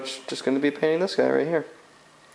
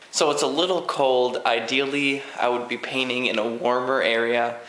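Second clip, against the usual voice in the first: ordinary delivery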